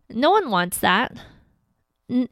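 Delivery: ordinary talk, nothing marked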